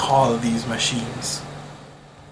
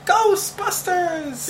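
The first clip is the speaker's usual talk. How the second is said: excited shout; 13 semitones higher, much slower than usual. Real, not acted. Blurting out a guess.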